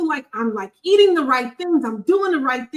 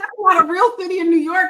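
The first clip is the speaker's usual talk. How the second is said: mocking disbelieving locals; 6 semitones higher, 4 dB louder, faster than usual. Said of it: "They be like".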